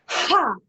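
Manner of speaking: loud high Ha blasted on the breath out; shouted Ha. Shown as a demonstration. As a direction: shout Ha from the belly. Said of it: "Breathe in".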